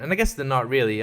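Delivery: ordinary talk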